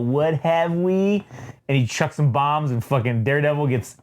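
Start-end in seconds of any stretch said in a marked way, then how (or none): 0.0-1.2 slow, drawn-out villain greeting; lightly acted sinister relish; mock villain gloating. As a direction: savour each word like a comic-book villain.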